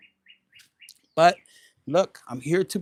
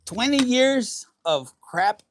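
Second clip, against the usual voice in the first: higher, louder, slow exasperated cry; fed-up outburst; 6 semitones higher, 6 dB louder, slower than usual. Pretend. Voicing a fed-up partner complaining about him, loud. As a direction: vent it like years of anger.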